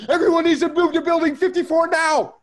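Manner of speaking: high, loud, frantic order over the radio; panicked radio call. Retold or imitated. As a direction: bark it like you're losing it. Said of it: "You don't wanna sound like you're panicked".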